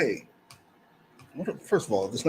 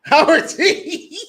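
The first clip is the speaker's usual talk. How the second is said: laughing; 8 semitones higher, 4 dB louder, much slower than usual. Real, not acted. Laughing repeat of the name.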